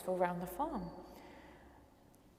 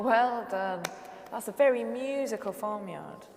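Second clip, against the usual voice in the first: normal talking voice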